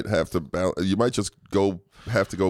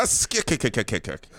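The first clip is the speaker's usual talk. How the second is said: Popeye. Pretend. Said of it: "That was my Popeye".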